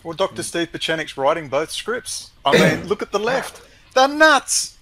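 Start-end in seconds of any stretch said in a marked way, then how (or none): 2.5-4.8 high, loud outburst; loud rant. Real, not acted. Mocking the left to the host, loud high outburst. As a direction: blurt it out in disbelief.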